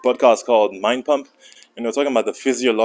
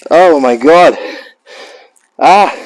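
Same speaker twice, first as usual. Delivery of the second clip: loud, strained exhale; exhausted groan; 4 semitones higher, 9 dB louder, slower than usual. Real, not acted. Finishing a brutal set, loud spent groan. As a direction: groan out the relief.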